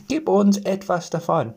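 little men voice. A narrator's reading. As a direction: ask sweetly like tiny forest men. Gnomes ask for bread.

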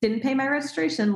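mock herself like a careless kid; clueless kid. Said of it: "like some kid who doesn't know".

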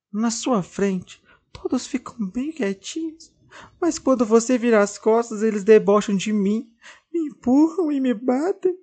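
high, trembling doll voice, hurt; mildly tearful high doll; sobbing doll. Acted. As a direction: confide the bullying through sobs. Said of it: "Ainda entre soluços".

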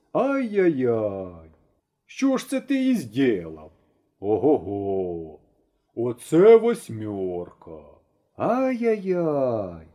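slow, drawn-out tutting villager; tutting neighbour. Acted. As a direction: cluck your tongue over the wreck, slowly. Villagers tut at bent wheel.

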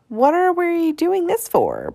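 put-on high, slow, puzzled boy; puzzled little kid. A narrator's reading. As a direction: ask it like a confused kid. Small kid asks.

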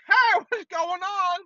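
high, drawn-out hello; uneasy greeting. Retold or imitated. His own cautious reply to angry wife.

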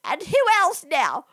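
high, hurt mock wailing; betrayed spouse. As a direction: play the wronged wife.

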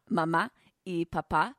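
Mama and Papa stressed at the end, ma-MA, pa-PA; careful Spanish Mama y Papa, weight on final syllables; last-syllable stress. Shown as a demonstration. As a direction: land hard on the end of Papa, pa-PA. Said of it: "the accent is on the, on the, on the last syllables".

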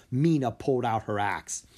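plain reading voice

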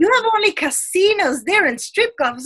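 high, mocking imitation of clueless people; mocking quote. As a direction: mimic the cliché about Vegas with scorn. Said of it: "they're like".

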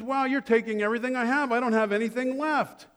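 high, quick acted protest; mock dismay, pitched well up. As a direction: play a buyer stunned at losing everything. Man protesting total loss, acted.

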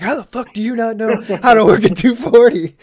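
loud, high incredulous demand; hammed-up interrogating bark; mock grilling. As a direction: grill a clueless cadet. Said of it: "grilling these two cadets right next to us".

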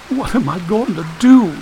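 put-on high, distressed girl's voice; starting to cry. A narrator's reading. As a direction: wail it helplessly, close to tears. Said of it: "she started crying".

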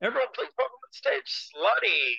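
hammed-up stage announcer. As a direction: introduce the act like an MC. Hyping a community night guest.

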